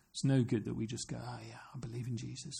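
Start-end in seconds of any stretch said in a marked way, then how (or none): 1.2-2.5 quick, breathy whispered aside; mock whisper. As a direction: mimic a lukewarm believer under your breath. Mocking a half-hearted believer.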